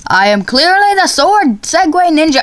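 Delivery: high, hammed-up proclamation; playful ninja boast. Gamer proclaiming himself the sword Segway Ninja, hammed-up boast. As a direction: declare yourself the mock hero.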